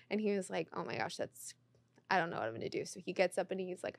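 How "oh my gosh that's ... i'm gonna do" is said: very soft, nearly whispered fluster; retold hushed panic. Husband sees his idol sit in their row, hushed panic. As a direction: imitate a starstruck fan keeping it under their breath.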